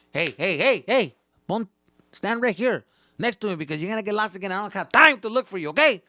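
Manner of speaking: scolding dad. Retold or imitated. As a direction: bark orders at a kid who wanders off. Dad scolding kid in store.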